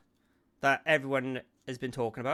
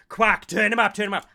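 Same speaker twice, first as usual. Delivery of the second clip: loud, higher mock pestering; imitated nagging demand; 5 semitones higher, 10 dB louder than usual. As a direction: act out someone loudly demanding. Acting out Ross's nagging messages.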